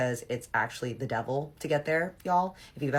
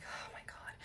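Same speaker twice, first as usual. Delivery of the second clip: whispered under the breath in dismay; barely voiced, weary retold aside; exasperated whisper; 20 dB quieter than usual. Reacting to her ex's plea to come back, exasperated whisper.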